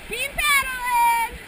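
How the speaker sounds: loud, slow call; shouted command. Real, not acted. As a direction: yell it over the water. Guide shouting command in rapids.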